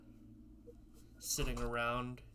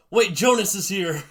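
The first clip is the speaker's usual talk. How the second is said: excited greeting; 8 semitones higher, 17 dB louder than usual. Real, not acted. Greeting an arriving friend.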